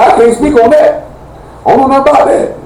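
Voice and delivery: high-pitched retold denial; evasive denial. Repeating to his friend that he can't speak on it, evasive. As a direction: pitch it way up and play innocent.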